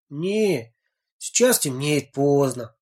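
plain reading voice

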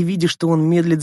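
ordinary delivery, nothing marked

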